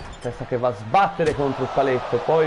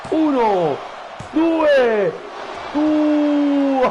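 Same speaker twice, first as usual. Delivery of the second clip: excited commentary; 5 semitones higher than usual. Real, not acted. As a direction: build to a shout on the dive. Finisher from the top rope.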